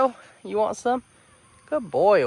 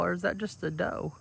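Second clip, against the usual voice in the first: ordinary delivery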